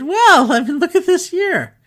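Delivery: high, loud sympathetic exclamation, slowing at the end; retold emphatic sympathy. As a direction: burst out in disbelief at her awful year. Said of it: "And I said".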